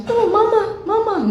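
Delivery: put-on high whimpering child voice calling mama; mild imitation of a crying child; imitating child crying. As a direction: mimic a small child's tearful cry, high. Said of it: "tapi dia nangis".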